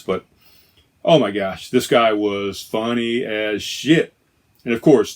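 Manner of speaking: ordinary delivery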